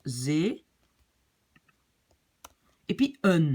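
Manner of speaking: "zee", a long pause, then a separate "un"; syllable by syllable. Shown as a demonstration. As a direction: split it in two: "zee" first, then "un" on its own. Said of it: "ben d'abord je prononce".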